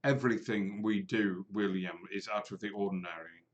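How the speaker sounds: low, softened character voice; Mordru, dryly dismissive. Acted. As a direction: point out the obvious in a low, quiet voice. DM voices Mordru answering William.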